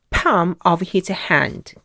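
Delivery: ordinary delivery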